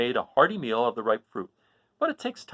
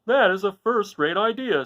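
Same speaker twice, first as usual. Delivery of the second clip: higher, louder with approval; pleased; 8 semitones higher, 5 dB louder than usual. Acted. The lion praises the Scarecrow's plan, pleased. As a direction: cheer the idea on. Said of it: "said the lion".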